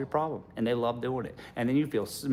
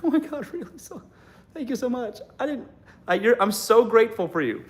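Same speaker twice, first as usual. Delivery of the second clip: high, squealing mock gratitude; overdone breathless thank-you; gushing girlfriend; 9 semitones higher than usual. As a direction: gush like a thrilled girlfriend, pitched way up.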